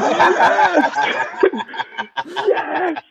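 very high, laughing mock cheer; retold elation. Acting out his reaction to the kid's insult, elated. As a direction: cheer it in a squealing high pitch.

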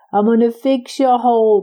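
plain reading voice

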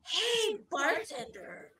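hammed-up drunk yelling for the bartender; mock drunk woman. Drunk patron calling out to the bartender, hammed-up and loud. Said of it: "so drunk, just leaning into her".

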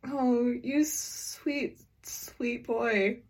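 slow, soft, indulgent coo; tender mock pity. Friend pitying the one who asked about Tumblr, tender mock pity. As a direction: coo it slowly, as to an innocent. Playful pity at innocent question.

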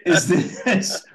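high, laughing exasperation; laughing disbelief. Real, not acted. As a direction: ask it through real laughter. Laughing at his own disappointment.

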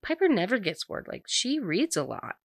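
1 cheerful mom. Retold or imitated. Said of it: "her mom speaks up and is like".

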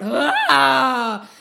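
loud, drawn-out open-throated roar; lion-man's roar. A narrator's reading. Narasimha roars as he fights, thunderous roar. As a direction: let out a long roar. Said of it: "and he roared".

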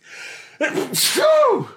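sudden loud high sneeze. Real, not acted. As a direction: let a real sneeze burst out. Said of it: "I'm gonna sneeze".

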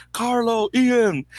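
high called-out names, retold lightly; calling out names. Fan calls to the fly halves from the sideline, high calling out. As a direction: call across the field to the players. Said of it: "I would just scream their names".

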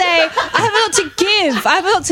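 high, flustered protest through laughter; flustered laughing. Real, not acted. Speaker defends a clumsy phrase to teasing friends, flustered and laughing. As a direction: explain yourself while laughing.

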